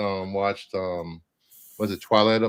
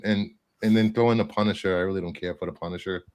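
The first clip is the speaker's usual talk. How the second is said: normal talking voice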